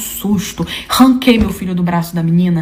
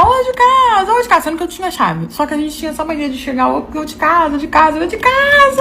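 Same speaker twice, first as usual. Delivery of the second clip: high-pitched mock call at the door; 7 semitones higher than usual. Daughter announcing herself at mother's house, mock calling out. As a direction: imitate hollering to announce an arrival. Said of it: "comecei a gritar".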